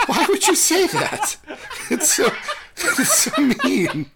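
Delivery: high, whiny, laughing hurt protest. Retold or imitated. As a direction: play wounded and whine it. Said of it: "And I was like".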